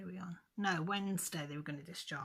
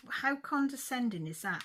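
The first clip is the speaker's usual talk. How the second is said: higher and louder, reliving the fury at the nurse; retold furious outburst; 7 semitones higher, 5 dB louder than usual. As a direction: snap it back at her like you did that day. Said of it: "Well, I lost it".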